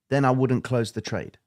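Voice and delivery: ordinary talk, nothing marked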